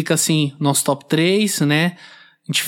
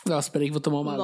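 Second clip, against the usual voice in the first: fast, softer, strained after choking; 8 dB quieter, much faster than usual. Real, not acted. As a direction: rush it out before coughing. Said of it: "Eu me engasguei".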